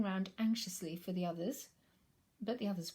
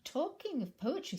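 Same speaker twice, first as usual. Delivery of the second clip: Pooh's voice; 9 semitones higher, 4 dB louder than usual. Acted. Pooh speaks, high voice.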